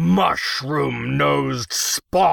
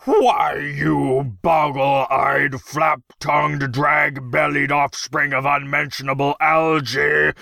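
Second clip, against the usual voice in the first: loud, high, drawn-out insults; infuriated tirade; 4 semitones higher, 4 dB louder, slower than usual. A narrator's reading. Infuriated emperor abusing the striking gnome, furious tirade. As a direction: roar each insult with relish.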